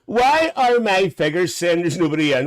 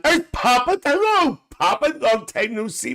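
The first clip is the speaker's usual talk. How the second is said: high mock greeting; 10 semitones higher than usual. Greeting Willie coming home.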